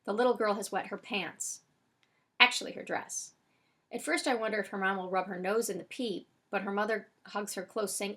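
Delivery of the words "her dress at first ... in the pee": plain reading voice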